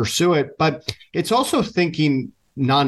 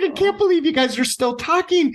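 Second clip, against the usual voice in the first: much higher, incredulous wife voice; surprised wife. Retold or imitated. Wife catching husband on video chat late, high incredulous voice. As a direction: squeak it out like a stunned wife. Wife teary-eyed, amazed.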